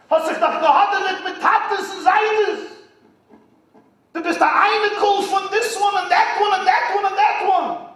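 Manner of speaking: mock scolding of shocked rabbis; incredulous elders. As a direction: berate him as a scandalized elder. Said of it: "The tzaddikim around Moshe said".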